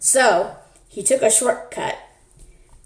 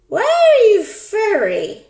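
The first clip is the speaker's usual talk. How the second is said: put-on much higher, louder, slow puzzled girl; little girl voice; 13 semitones higher, 5 dB louder, slower than usual. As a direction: ask it as a bewildered child.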